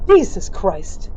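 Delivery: loud, slow, jolted; startled shout. Acted. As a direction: blurt it out in fright. Bridger startled outburst.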